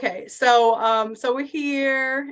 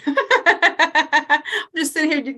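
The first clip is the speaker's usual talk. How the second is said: laughing; 4 semitones higher, 5 dB louder, slower than usual. Real, not acted. Caught off camera, laughing at herself.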